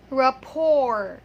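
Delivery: "rapport" loud and drawn out, ra-POR; correct silent T. Shown as a demonstration. Teacher giving the correct pronunciation, silent T. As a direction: leave the T off "rapport". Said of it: "the correct pronunciation of that word is".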